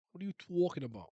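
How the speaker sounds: Boston vowels in "talking"; Boston accent. Retold or imitated. Streamer imitating Boston locals, put-on Boston accent. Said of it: "they got the accent in Boston".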